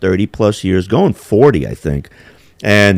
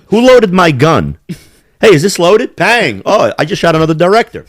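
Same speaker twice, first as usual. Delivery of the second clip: high, careless mock actor voice; mock Alec Baldwin; 6 semitones higher than usual. Mocking Alec Baldwin on the Rust set, careless and high. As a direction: play the clueless shooter, hammed-up.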